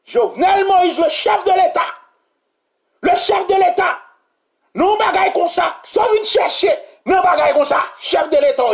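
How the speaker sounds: full-voiced indignation; angry rant. Real, not acted. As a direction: yell at the president. Ranting at the head of state.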